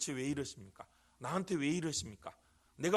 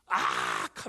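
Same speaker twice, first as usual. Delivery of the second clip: sharp, much lower burst of a yelp; short scream demo; 5 dB louder, much slower than usual. Shown as a demonstration. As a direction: bark out a sudden pained cry. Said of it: "하면서 비명을 지르나". (짧고 날카로운 비명)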